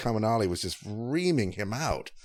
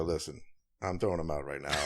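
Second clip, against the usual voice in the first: low, quiet aside to bandmates; imitating a hushed, resolved heads-up; retold confiding; 5 semitones lower, 9 dB quieter, faster than usual. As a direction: drop it low and soft, laying down a decision.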